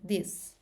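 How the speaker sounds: "this" opening on a vibrating, D-like TH; voiced TH. Shown as a demonstration. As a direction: voice the first sound of "this", close to Z or D. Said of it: "o som vozeado com vibração".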